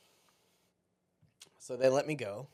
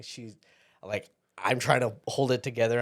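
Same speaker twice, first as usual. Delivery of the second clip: usual speaking voice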